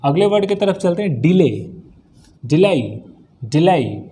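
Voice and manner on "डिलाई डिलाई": "डिलाई" ending in an "-aai" glide; taught pronunciation. Shown as a demonstration. Teacher shows students how to read the word, "डिलाई" with an "-aai" ending. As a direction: model "डिलाई", last syllable rhyming with "eye". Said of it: "इसको डिले नहीं पढ़ते".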